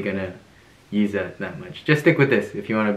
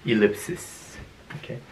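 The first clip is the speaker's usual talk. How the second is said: ordinary talk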